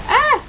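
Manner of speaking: short, high, loud yelp; sudden yelp of real fright; startled scream. Real, not acted. Player jumps at a creepy game enemy, startled scream.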